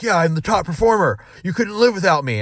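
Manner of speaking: high-pitched imitation of a cocky star; mock boasting. Acts out boastful top performer.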